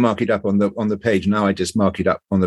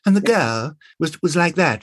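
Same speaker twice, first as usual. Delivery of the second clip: put-on higher-pitched, sweet period girl; sweet 1920s girl; 6 semitones higher than usual. Shown as a demonstration. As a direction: play the lovely young English girl. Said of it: "rather sort of sweet and lovely".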